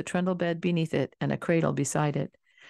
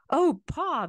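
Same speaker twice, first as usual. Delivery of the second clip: high, excited shout; boys shouting; 12 semitones higher than usual. A narrator's reading. Boys greeting their pa at the door, excited shout. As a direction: boys running out to greet father. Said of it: "the boys shouted".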